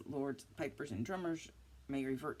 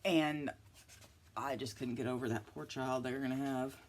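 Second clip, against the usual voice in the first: normal talking voice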